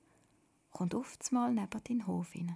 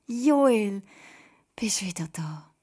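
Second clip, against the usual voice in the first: hoch und laut, dann leiser nachgefragt; begrüß ihn überglücklich; freudig überrascht; 10 dB louder, slower than usual. Acted. Wiedersehen mit dem Bruder. (Miriam freut sich, Joel wieder zu sehen, freudig)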